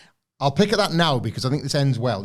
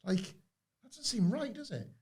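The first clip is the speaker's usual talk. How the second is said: incredulous; 12 semitones higher, 14 dB quieter than usual. Real, not acted.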